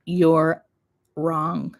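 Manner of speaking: normal talking voice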